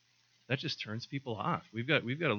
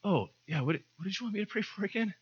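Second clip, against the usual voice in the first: embarrassed forgetful pray-er; 9 semitones higher, 4 dB louder, faster than usual. Retold or imitated. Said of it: "pretty embarrassing when you're like".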